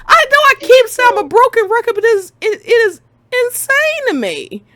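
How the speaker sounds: high, strained near-wail of disbelief; real exasperated high whine. Real, not acted. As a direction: plead in squeaky frustration. Exasperated rant, high pitch.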